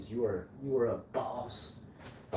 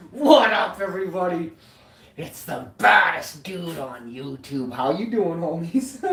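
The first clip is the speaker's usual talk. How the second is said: hyped greeting; 5 semitones higher, 9 dB louder than usual. Acted.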